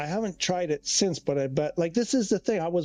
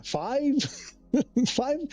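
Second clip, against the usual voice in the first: surprised; 8 semitones higher than usual. Real, not acted. Disbelief at the number five.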